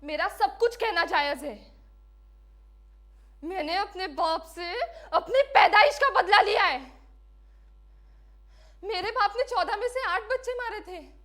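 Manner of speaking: high, loud, defiant outcry; impassioned protest. Acted. Condemned woman protests being cut off. (ऊँची आवाज़ में तीखा विरोध)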